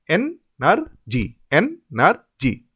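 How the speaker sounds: energy slowly split as en-ar-jee; three separate beats in energy, with gaps; syllable by syllable. Shown as a demonstration. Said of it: "In each of the individual syllables".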